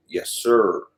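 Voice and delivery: ordinary delivery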